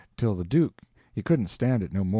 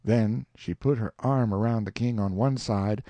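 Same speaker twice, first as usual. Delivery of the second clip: ordinary delivery, nothing marked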